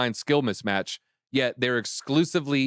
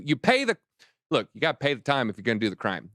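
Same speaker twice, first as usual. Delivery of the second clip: ordinary talk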